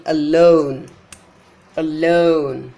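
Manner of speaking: alone as uh-LONE, long LONE; weak a, then a strong stretched LONE in alone; second-syllable stress. Shown as a demonstration. Teacher showing unstressed prefix, alone stressed on LONE. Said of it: "Loan is trust".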